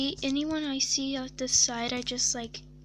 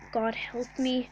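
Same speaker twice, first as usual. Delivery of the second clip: ordinary talk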